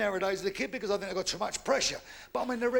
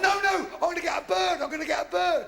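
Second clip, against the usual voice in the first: higher, louder thrilled yell; childish shouted glee; excited child shout; 9 semitones higher, 6 dB louder than usual. Retold or imitated. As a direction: shout it like an overjoyed kid. Child excitedly guessing present.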